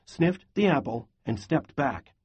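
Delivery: ordinary delivery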